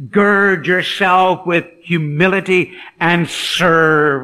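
loud, drawn-out proclamation; emphatic exhortation. Acted. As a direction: exhort slowly and forcefully. Preacher proclaims Peter's written exhortation.

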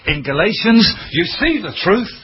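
pitched well up, building passion; rising preaching fervour. Real, not acted.

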